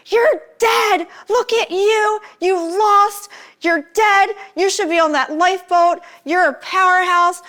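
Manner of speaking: high-pitched, emphatic mock tirade, easing toward the end; berating rant, starting high and gradually coming down; retold screaming. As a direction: imitate someone screaming in your face, pitch way up. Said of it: "starts screaming at me".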